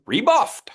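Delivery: high rising Rebuffed; playful rising Rebuffed; savoured word. Shown as a demonstration. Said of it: "You like that word?".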